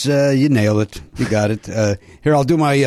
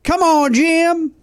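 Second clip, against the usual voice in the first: roommate Kurt; much slower than usual. Retold or imitated. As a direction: pitch it way up like the roommate. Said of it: "That's, that's Kurt".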